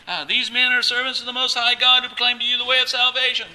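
high, loud wail held on one pitch; imitated screaming. Preacher mimicking the slave girl Paul heard for days, screaming. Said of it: "this lady is screaming".

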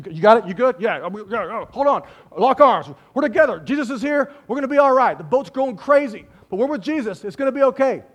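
rally the crew like a team huddle. Acted. Disciples lock arms in the storm, rallying.

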